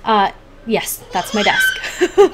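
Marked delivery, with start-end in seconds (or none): 1.1-2.3 breaks into a high laugh on the words. Real, not acted. Vlogger showing her desk to viewers, laughing. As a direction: giggle through it, slow.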